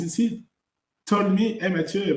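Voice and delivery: normal talking voice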